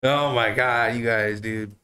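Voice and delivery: ordinary talk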